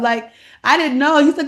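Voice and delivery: ordinary talk, nothing marked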